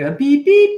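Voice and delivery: high, loud mock beeping; a coach mimicking the Skype dial tone; imitated beeps. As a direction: beep like a call connecting. Imitating Skype call tones.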